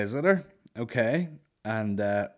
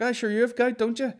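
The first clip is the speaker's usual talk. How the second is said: imitating granny; 14 semitones higher, 6 dB louder than usual. Granny voiced in a story.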